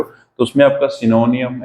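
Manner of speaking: usual speaking voice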